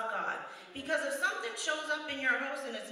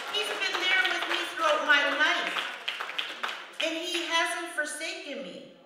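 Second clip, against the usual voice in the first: preaching build; 5 semitones higher, 5 dB louder, slower than usual. Real, not acted. Preaching climax on God's presence.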